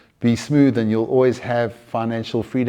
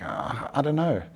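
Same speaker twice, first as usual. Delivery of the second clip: unsure photographer, acted; 3 semitones higher, 9 dB quieter than usual. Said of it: "photographers with low self-worth".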